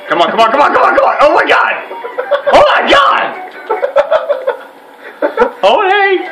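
high, loud excited cheering; yelling at a horse race. Real, not acted. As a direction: cheer it on, laughing and shouting. Cheering during minigame.

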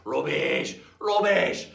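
loud scornful heckle; jeering pirates. Acted. Pirates heckle their boastful mate, loud scorn. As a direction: throw each word out loud and falling. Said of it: "And they went".

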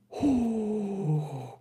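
high falling wail of amazement; long, loud, overwhelmed howl; loud amazed "oh". Real, not acted. Streamer reacts to the dream card, high amazed wail. Wordless reaction to rare pull.

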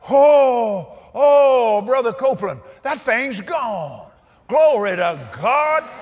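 lightly voiced joyful woman, slowing at the end; healed woman, relieved. Healed woman speaks after deliverance.

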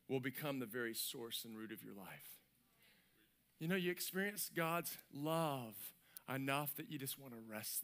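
low, very soft preaching; hushed reverence. Real, not acted. Preacher on resting in God's love, hushed reverence. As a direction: let it sink to an intimate hush.

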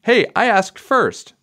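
high, slow whiny protest; complaining. A narrator's reading. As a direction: whine that you asked first. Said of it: "Michael complained".

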